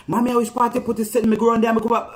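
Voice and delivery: put-on high, loud, fast Jamaican patois child; broad Jamaican child. Daughter suddenly talking to mommy in high, loud, fast patois. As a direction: rattle it off like a little Jamaican girl. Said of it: "She went so Jamaican so fast".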